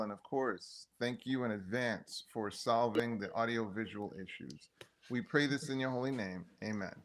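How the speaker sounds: soft, earnest spoken prayer. Acted. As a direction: pray quietly and sincerely. Leads an opening prayer.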